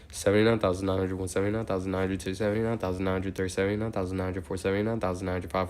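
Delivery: steady, rhythmic number chant; monotone counting. Real, not acted. Counts aloud toward eighty thousand.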